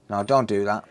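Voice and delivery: normal talking voice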